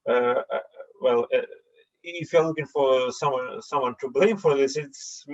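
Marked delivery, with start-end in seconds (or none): none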